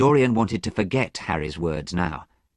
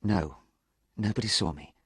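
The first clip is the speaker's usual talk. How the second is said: softer, subdued answer; quiet reply; 7 dB quieter than usual. A narrator's reading. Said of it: "replied Dorian quietly".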